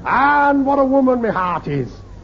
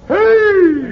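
loud, high straining heave; shouted heave; 10 semitones higher, 6 dB louder than usual. Acted. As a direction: yell it as you haul the rope. Crew hauling on ropes.

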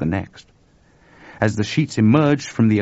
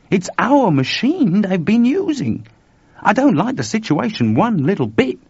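worried Chuck protesting; 8 semitones higher, 4 dB louder than usual. Acted. Chuck warns George they may get the blame, alarmed. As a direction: hammer home the danger, pitch climbing.

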